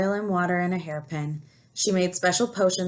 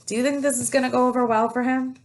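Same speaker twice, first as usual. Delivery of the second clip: gasp; 6 semitones higher, 3 dB louder, faster than usual. Real, not acted. Teacher gasps to children.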